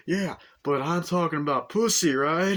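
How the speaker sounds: hammed-up laddish pitch; brash salesman. Car salesman to Arnie, hammed-up brashness.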